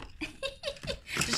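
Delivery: light giggle at own joke; rire. Real, not acted. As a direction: giggle after a mock boast. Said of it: "Je rigole". (petit rire amusé)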